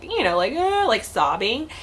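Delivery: ordinary talk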